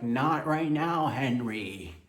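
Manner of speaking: a drawn-out brush-off as the partner; mock partner voice.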